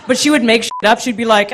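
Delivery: usual speaking voice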